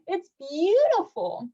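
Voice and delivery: slow, high, awed cry of delight. A narrator's reading. Little Melba spotting the trombone, crying out in delight. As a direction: gasp it out in wonder at the horn. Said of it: "she cried".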